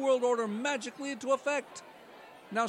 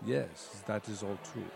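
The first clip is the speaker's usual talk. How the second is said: put-on much lower, German-accented villain voice; Klaus Schwab impression; 14 semitones lower, 4 dB quieter than usual. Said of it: "Klaus Schwab".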